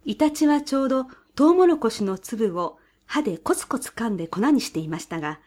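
ordinary delivery, nothing marked

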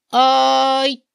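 high, loud drawn-out cry in reply; 涙声の精一杯の叫び. A narrator's reading. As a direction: call back at full voice, as a tearful child. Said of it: "涙声を震わせて精一杯に叫んだ". (高く長く張り上げた涙声の返事)

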